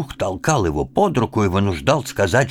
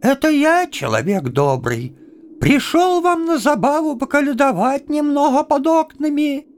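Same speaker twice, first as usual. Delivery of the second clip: very high put-on falsetto; high-pitched fake caroller; disguised voice. A narrator's reading. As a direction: disguise yourself with a squeaky-high voice. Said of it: "переменив голос, отвечал".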